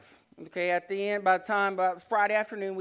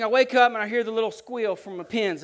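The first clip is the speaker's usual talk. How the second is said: ordinary talk, nothing marked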